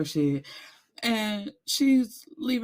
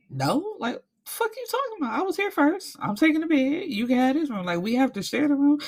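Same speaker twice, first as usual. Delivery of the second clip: mock furious refusal; 8 semitones higher than usual. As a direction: voice shooting up in disbelief at her. Retold heated refusal.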